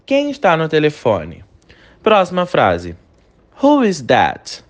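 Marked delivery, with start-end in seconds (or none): 3.6-4.6 slow, clear English model phrase; teacher modelling slowly for repetition; slow model sentence. Shown as a demonstration. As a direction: say it slowly so students can repeat. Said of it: "Repete a pronúncia comigo".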